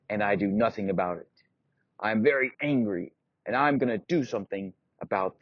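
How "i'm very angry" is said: plain reading voice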